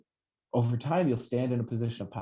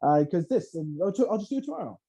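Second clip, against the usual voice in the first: high, lazy whiny excuse; mock excuse-making voice; 8 semitones higher than usual. A host mocking people who put things off, high whiny voice. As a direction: whine like someone dodging the day. Voicing a procrastinating ego.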